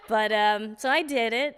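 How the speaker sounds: normal talking voice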